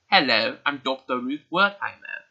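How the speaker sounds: playful imitation British accent, introducing herself. Vlogger introducing herself in a mock British accent. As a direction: pretend to be a British doctor in an amateur accent. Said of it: "I love how them and Australian people talk".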